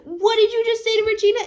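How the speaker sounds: fast, high, pushy jab of a line; aggressive challenge. Retold or imitated. Boyfriend squares up to Julia, high fast challenge. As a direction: puff up and get in her face. Boy toy confronts Julia.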